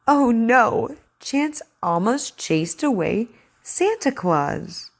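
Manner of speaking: slow, clear story reading; over enunciating. Acted. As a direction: read to a child, carefully. Reads the story aloud for practice.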